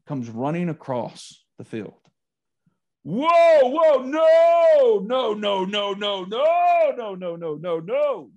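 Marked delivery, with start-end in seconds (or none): 3.0-8.3 high, loud drawn-out string of protests; imitated referee yelling. Referee runs at coach across field, imitated yelling. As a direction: holler it as if stopping play across a field. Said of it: "yelling. I mean, at the top of his lungs".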